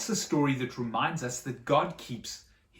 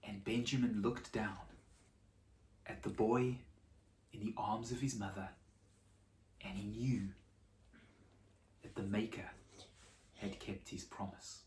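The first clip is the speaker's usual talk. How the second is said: soft, lower, reverent narration; hushed storytelling; 4 semitones lower, 4 dB quieter than usual. A narrator's reading. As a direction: draw the children in quietly.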